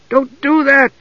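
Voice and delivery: high, urgent cry of alarm; alarmed warning. A narrator's reading. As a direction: stop him with a high-pitched warning. Usha grabs Tass from the edge.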